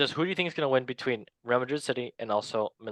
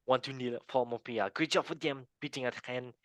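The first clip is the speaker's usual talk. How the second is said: hammed-up French accent; 2 semitones higher than usual. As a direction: call the scores in a put-on French accent. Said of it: "We can go to France accent".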